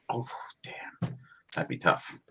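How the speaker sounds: hushed joking aside; whispering. Real, not acted. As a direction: whisper it like a secret.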